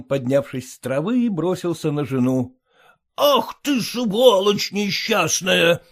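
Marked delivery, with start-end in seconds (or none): 2.9-5.8 slowed, hammed-up angry roar. A narrator's reading. Husband curses his wife, mild roar. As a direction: spit the curse out slowly. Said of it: "заревел он".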